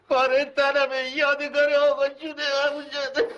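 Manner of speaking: high, tearful plea; crying. Acted. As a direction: plead through tears about a brother.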